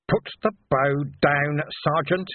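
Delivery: slow, muffled order; slightly muffled. Acted. Commander flat on the ground ordering his sergeant, slightly muffled. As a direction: speak muffled, managing each word slowly. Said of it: "his voice was slightly muffled".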